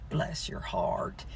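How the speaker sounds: very soft, slow "bless your heart"; mock pity, almost whispered; Southern sympathetic murmur. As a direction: draw it out under the breath, Southern style. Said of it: "that's how Southern people say".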